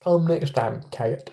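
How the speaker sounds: quiet Southern US impression; Forrest Gump. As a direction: repeat it as a Southern film character. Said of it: "I sounded a bit like Forrest Gump".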